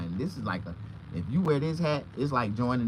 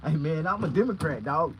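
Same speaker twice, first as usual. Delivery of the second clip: nervous backpedal; 6 semitones higher, 5 dB louder than usual. Retold or imitated. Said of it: "I was like".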